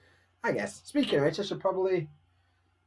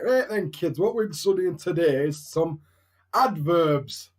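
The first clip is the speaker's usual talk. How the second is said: teacher voice; 2 semitones higher, 6 dB louder, slower than usual. Acted. Teacher greeting kids with today's lesson, in a loud teacher voice. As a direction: project it like a teacher opening class. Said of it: "I've got a teacher voice".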